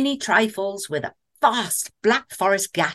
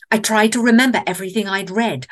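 plain reading voice

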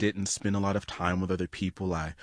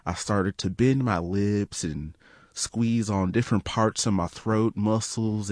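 normal talking voice

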